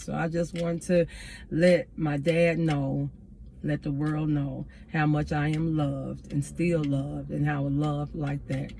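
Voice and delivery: soft, slow, low tribute; tender. Real, not acted. Daughter honouring her late father, tender and slowly fading. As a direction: let it sink into a hushed, heartfelt close.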